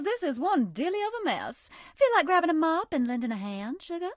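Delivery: put-on a coy Southern girl sweet-talking a new friend; Southern belle character. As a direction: play the sweet, teasing Southern charmer. Voiced game character Bonnibel lines.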